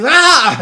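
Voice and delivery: high, loud hammed-up yell with a laugh; mock yell. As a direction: fake a silly angry yell. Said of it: "he's yelled deeper than that".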